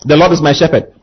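usual speaking voice

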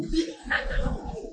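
high, soft wheezy laughter; amused laugh. Real, not acted. Preacher joking with congregation about what they ate, amused laugh. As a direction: laugh helplessly at your own joke. Laughing at own poo-poo joke.

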